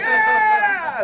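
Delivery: huge high yell of a cheering crowd; cheering shout. Retold or imitated. Actors cheering at his stunt, yelling very loud and high. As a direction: roar it like a crowd on set. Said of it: "They were like".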